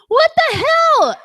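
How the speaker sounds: high, loud, slow what the hell; mock horrified outcry; shocked. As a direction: shriek it in stunned disbelief. Retold shocked reaction.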